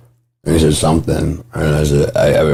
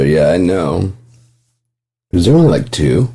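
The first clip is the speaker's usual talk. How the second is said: ordinary talk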